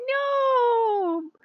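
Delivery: very high, crying mock protest; mock wail. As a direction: whine it like a heartbroken child. Mock despair at being rejected.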